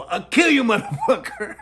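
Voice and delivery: high, loud threat breaking into laughter; laughing mock threat. Cop threatening the nun, laughing while yelling. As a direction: yell it and crack up.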